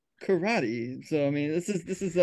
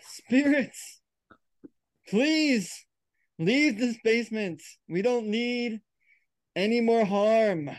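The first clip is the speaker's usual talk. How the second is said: higher, drawn-out plea to the spirits; séance invocation; 4 semitones higher, slower than usual. Acted. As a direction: implore the spirits, pausing after each phrase.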